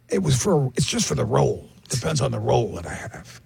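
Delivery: low, subdued retold answer; Al Pacino. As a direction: imitate the actor's offhand explanation, softening at the end. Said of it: "paused for a second and said".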